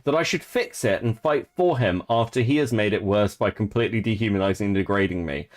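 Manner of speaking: normal talking voice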